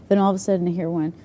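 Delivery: normal talking voice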